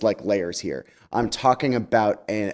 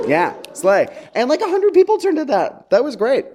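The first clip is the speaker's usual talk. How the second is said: much higher, louder, gleeful; excited cheer; 13 semitones higher, 6 dB louder than usual. Real, not acted. Speaker cheers about the hundred-person church turnout, thrilled. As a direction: celebrate like a big win.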